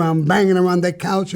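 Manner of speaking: usual speaking voice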